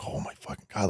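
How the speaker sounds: hushed, unvoiced oh my God; barely breathing the words out; breathless whisper. Whispering to his scarred dad in the basement. Hugging dad after surgery.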